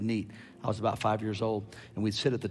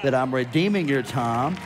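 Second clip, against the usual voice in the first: slow, building louder prophecy; prophetic declaration; 2 semitones higher, 5 dB louder, slower than usual. Acted. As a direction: declare each time stronger. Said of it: "the Lord says".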